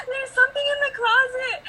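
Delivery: little sister scared. Retold or imitated. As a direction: play the frightened little sister.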